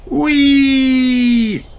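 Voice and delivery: high gleeful whee; cheer. Acted. Daddy scooting home, squealing happily. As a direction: squeal like a kid. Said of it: "he cheers".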